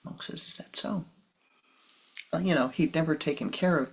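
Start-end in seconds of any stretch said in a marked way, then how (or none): 0.5-1.0 soft, unbothered reply; calm acceptance. Acted. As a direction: let it go gently.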